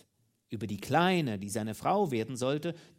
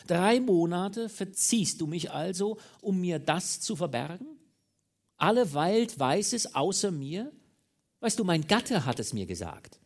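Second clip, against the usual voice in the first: raised, loud voice full of outrage; furious indignation; 6 semitones higher, 6 dB louder than usual. A narrator's reading. Mistress reproaching lover for hiding it three months, outraged. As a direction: build from dismay to loud reproach. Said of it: "wütend, voller Entrüstung".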